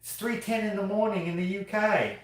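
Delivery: high-pitched, hammed-up British accent; silly mock UK accent, high throughout; put-on UK accent. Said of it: "then I would have been like".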